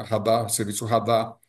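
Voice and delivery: ordinary talk, nothing marked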